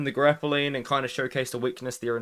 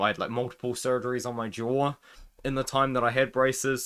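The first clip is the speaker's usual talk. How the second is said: ordinary talk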